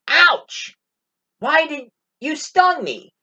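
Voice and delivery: high, pained yelp of a stung dog; hurt dog. Retold or imitated. The dog, stung mid-river by the scorpion, cries out high. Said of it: "And the dog was like".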